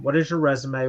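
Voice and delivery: normal talking voice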